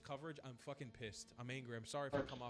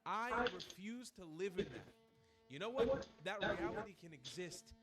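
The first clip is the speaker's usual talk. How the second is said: slow, high, heated protest; impassioned outrage; 7 semitones higher, 5 dB louder, slower than usual. Real, not acted. YouTuber raging at the attack on his people, slow and heated. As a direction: hammer each word out slowly in outrage.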